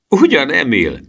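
high, loud exclamation, falling at the end; exclaimed protest. A narrator's reading. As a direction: cry out in good-natured protest. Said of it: "kiáltotta a felügyelő". (felkiáltva, tiltakozón)

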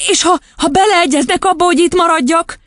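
put-on high, eager little-girl voice; squeaky, hesitant-start questioning; impatient question. A narrator's reading. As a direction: press impatiently like a child. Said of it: "kérdezte türelmetlenül a kislány".

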